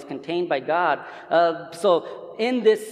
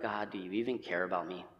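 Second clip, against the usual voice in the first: much softer and lower, a small plea; hushed doubting plea; 10 semitones lower, 14 dB quieter than usual. Retold or imitated. Believer in hard times quietly asking God if he cares, hushed. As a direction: murmur it like a private doubt.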